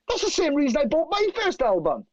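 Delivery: high-pitched, thrilled outburst; retold excitement, voice way up; excited amazement. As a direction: blurt it high, delighted by the coincidence. Acts Duff's amazed reply.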